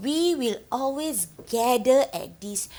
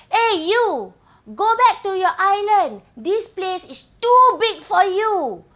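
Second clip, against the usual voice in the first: loud, high acted jeer; mocking taunt; 6 semitones higher, 6 dB louder than usual. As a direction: play bullies shouting someone back home. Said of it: "making fun of my home".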